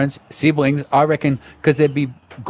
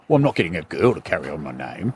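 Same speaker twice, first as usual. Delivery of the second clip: imitating a dad refusing a daughter heir; mock blokey dad; 7 semitones lower, 5 dB quieter than usual. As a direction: lower and softer, a mock gruff dad. Mocking a man's view.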